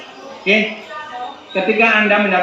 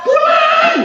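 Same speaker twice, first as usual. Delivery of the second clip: very high, long calling shout; demonstrated parent's yell; 4 dB louder than usual. Shown as a demonstration. Parent calling a child home, very high drawn-out yell. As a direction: holler it again at a much higher pitch. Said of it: "Misalnya Anda teriak".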